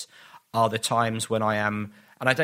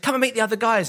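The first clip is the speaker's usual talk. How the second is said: cheery invite; 10 semitones higher, 3 dB louder, faster than usual. Retold or imitated.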